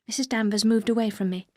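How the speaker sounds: usual speaking voice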